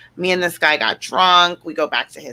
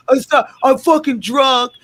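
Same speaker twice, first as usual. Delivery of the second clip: put-on drunk guy; 6 semitones higher, 4 dB louder than usual. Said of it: "He's like".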